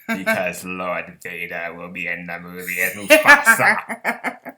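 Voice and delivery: slow menacing Sith lord voice; Palpatine voice. Pretend. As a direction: drag it out like a dark lord. Villain voice continuing Palpatine bit.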